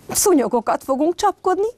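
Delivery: high, proud little cub; Dörmi announcing triumphantly to his siblings, high; triumphant announcement. Acted. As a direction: announce the plan like a gleeful child. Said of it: "jelentette ki diadalmasan Dörmi".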